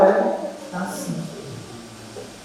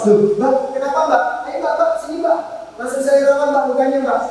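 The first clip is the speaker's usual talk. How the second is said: fast, urgent, higher-pitched questions to the patient; quick urgent nurse; 4 semitones higher than usual. Shown as a demonstration. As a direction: rush in to help, voice climbing. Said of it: "intonasi komunikasi kita percepat". (bertanya cepat dan sigap)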